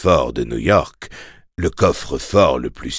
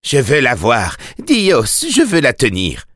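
higher, yearning and eager; impatient craving; 6 semitones higher than usual. Acted. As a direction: plead to hold her, urgently. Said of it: "malade d'impatience".

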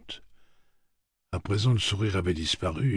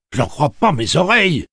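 much higher, much louder outraged cry; indignant exclamation; 12 semitones higher, 13 dB louder than usual. A narrator's reading. Farmer Tian outraged at the town's doubt, indignant shout. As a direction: burst out in disbelief, full of indignation. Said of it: "s'exclama Tian Jaffords avec indignation".

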